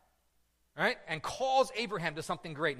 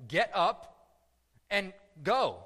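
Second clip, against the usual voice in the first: commanding voice; 4 semitones higher, 6 dB louder, much slower than usual. Retold or imitated. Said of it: "And the voice says".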